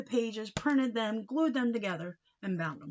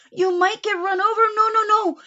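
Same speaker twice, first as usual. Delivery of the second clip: much higher and louder panic; alarmed cry; 11 semitones higher, 12 dB louder than usual. Acted. Uncle fearing his hat gets run over, loud panic. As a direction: shout the danger away.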